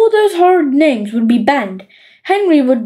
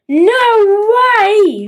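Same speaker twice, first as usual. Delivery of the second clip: higher, bratty shriek; 7 semitones higher than usual. A narrator's reading. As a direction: shriek it out in protest. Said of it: "shrieked Horrid Henry".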